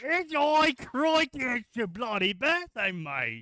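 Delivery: high, loud jumble of Aussie, Irish and Scottish; mixed mock accents. Showing off a mashed-up accent to laughing friends. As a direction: slide through accents mid-line. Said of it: "I counted Australian, Irish, and Scottish".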